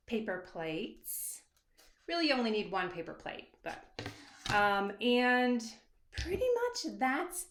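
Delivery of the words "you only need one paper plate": normal talking voice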